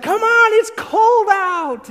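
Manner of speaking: very high, slow, loud holler; calling out. Retold or imitated. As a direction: imitate a wife hollering them indoors. Wife calls visitors inside.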